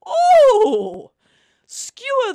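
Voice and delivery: sudden high loud yelp; startled exclamation, high and loud; surprised cry. Acted. Sudden cry while cooking.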